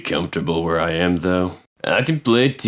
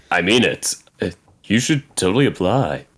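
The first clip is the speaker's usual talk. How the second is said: ordinary delivery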